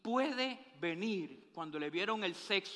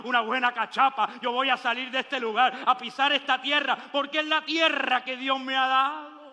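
loud, driving sermon cadence; preaching at full force; 3 semitones higher, 7 dB louder than usual. Acted. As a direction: preach it out to the congregation. (predicando con toda la fuerza)

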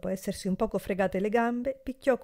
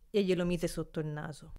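ordinary delivery

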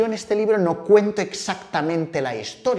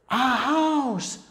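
very high, stretched storyteller awe; hammed-up amazement for toddlers; amazed wonder; 12 semitones higher, much slower than usual. Shown as a demonstration. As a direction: marvel as if it's the world's most spectacular thing. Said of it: "como si fuera la cosa más espectacular del mundo".

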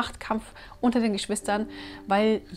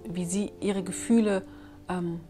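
normal talking voice

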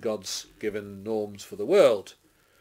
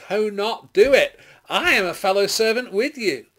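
high, loud urgent correction; emphatic forbidding; 10 semitones higher, 9 dB louder than usual. A narrator's reading. Angel to John falling at his feet, emphatic forbidding. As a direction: stop the listener with a firm raised voice.

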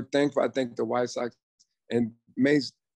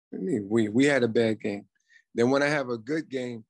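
ordinary talk

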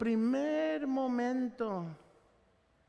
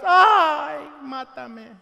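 whiny Jonah; 6 semitones higher, 11 dB louder, much slower than usual. Acted. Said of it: "Y otra vez Jonás".

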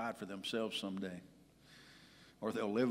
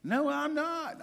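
put-on much higher, slow childish pout; 7 dB louder, much slower than usual. Husband pouting to his wife about dinner, childish whine. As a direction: whine it like a sulking kid. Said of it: "I'm pouting".

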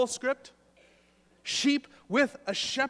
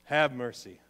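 [name] catchphrase; 10 semitones lower than usual. Retold or imitated. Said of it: "a singer who used to say that".

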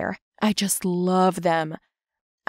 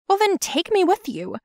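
high-pitched insistent plea; pleading; 11 semitones higher, 3 dB louder than usual. Acted. Daughter begging mother to take her along, high plea. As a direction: push to be taken along, pitch up.

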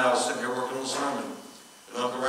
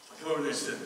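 normal talking voice

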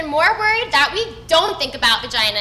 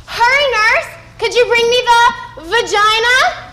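mock urgent doctor; 9 semitones higher, 4 dB louder than usual. Imagined doctor in the operating room.

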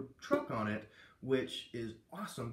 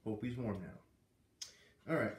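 usual speaking voice

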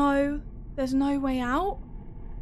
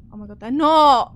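alarmed; 11 dB louder than usual. Real, not acted. Realising the eyeballs are not.

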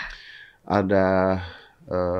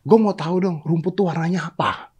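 1 role; 9 semitones higher than usual. Acted. Tiger complains to king.

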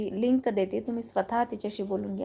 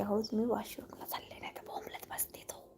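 hushed retelling; 13 dB quieter than usual. Retold or imitated. Mother quotes her daughter announcing a washroom trip, hushed. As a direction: quote the daughter very softly, trailing into a whisper.

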